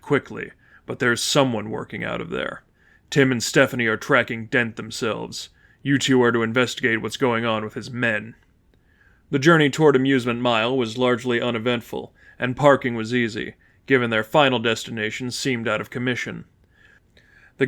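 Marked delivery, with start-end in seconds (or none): none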